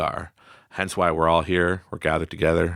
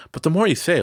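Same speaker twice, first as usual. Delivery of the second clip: ordinary talk, nothing marked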